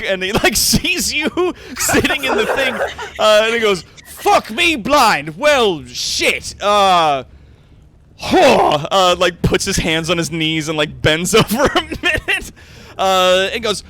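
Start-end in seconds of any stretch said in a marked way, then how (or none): 4.1-8.9 loud, drawn-out astonished swearing; flabbergasted, raised voice; stunned cursing. Acted. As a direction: curse aloud, knocked sideways by shock. Brewer stunned at seeing the ancestor.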